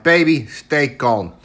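plain reading voice

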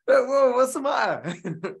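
high, slow startled voice; mock alarmed mum; imitating scared mum. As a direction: do his frightened mum with a jump in the voice. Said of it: "She gets so scared".

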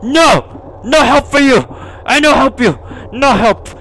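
high, loud repeated refusals; panicked shouting. Real, not acted. Player backing away from the ghost girl, panicked shouts.

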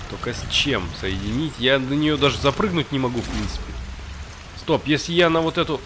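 ordinary delivery

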